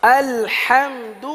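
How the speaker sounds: الحمد slow, final د with a clear u; درست الحمد; correct الحمد. Shown as a demonstration. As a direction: stretch الحمد and round the د. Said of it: "پیش کے اندر غلطی ہو رہی ہے".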